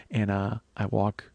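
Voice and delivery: ordinary talk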